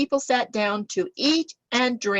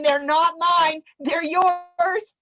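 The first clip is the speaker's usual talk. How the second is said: higher, slow "not mine", "yours" pushed; pointed retort; 7 semitones higher, 4 dB louder, much slower than usual. Acted. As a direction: hand it back firmly. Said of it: "He's saying".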